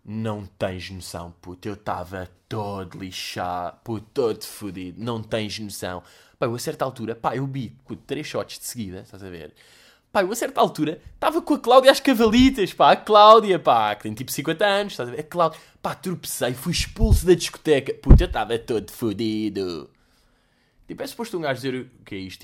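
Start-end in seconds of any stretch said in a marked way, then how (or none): 0.0-6.0 low, slow, lazy boast of being wrecked; bragging hungover friend. Retold or imitated. Friend bragging about last night's binge, low slow boast. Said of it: "e ele tá tipo". 6.4-19.9 put-on escalating drunk boast. Friend boasting about getting kicked out, loud excited brag. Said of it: "e ele tá tipo".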